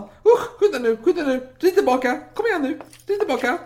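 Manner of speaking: very high, gasping, hammed-up horror; squealing mock panic with repeated yelps; panicked king. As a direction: fluster and gasp, then urge haste. Acts King Carl panicking at news.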